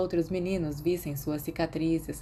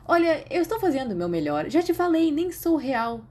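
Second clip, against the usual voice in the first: higher and louder, mock-defensive; exasperated imaginary friend; 9 semitones higher, 4 dB louder than usual. A narrator's reading. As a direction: protest you're only doing your best.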